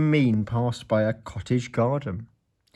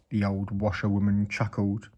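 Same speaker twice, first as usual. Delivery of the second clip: ordinary delivery, nothing marked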